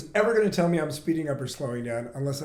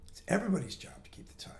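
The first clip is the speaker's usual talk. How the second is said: usual speaking voice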